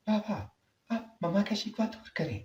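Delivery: softly begging her father; pleading daughter. Acted. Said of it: "بابا سے کئی بار کہا".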